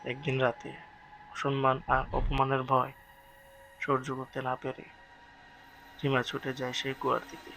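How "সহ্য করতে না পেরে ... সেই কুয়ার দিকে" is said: ordinary delivery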